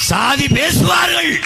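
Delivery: high, drawn-out oratorical cry; declamatory cry. Real, not acted. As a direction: call it out slow and very high.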